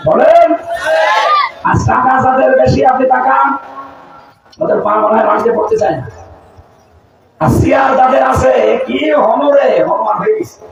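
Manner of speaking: high-pitched, forceful sermon delivery; impassioned preaching. Real, not acted. Preacher rebuking the rich and powerful, impassioned preaching. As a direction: call out high to the crowd.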